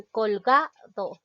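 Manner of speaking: "colgado" with the middle ga punched, do set apart after it; stress on GA. Shown as a demonstration. Teacher shows the next-to-last syllable is strongest, stress on GA. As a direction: hit the ga in "colgado", then drop to do. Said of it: "que es ga".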